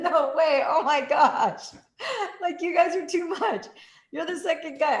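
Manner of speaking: high, loud delighted disbelief. Retold or imitated. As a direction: gush with happy surprise. Her delighted retold reaction.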